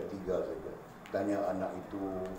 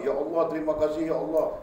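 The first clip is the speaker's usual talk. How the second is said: tearful thanks; 7 semitones higher, 6 dB louder than usual. Retold or imitated. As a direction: cry out thanks to God as the grateful poor man. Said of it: "menangis dia".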